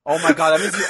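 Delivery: ordinary talk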